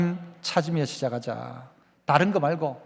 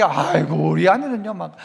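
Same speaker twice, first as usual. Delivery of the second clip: groaning complaint with drawn-out sighing "aigo"; mock spousal grumbling; slower than usual. Spouse complaining about the other's nagging, mock exasperated grumbling. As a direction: grumble in exasperation, stretching the wails. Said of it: "아내들이 남편 이야기".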